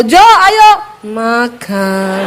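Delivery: comic forced Javanese fall on "makan"; falling-tone attempt. Shown as a demonstration. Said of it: "ngomong belakangnya harus turun".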